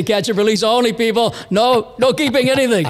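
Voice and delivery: lightly imitating a stern owner; mock-stern rule announcement; bossy lodge owner. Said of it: "he's got the balls to say".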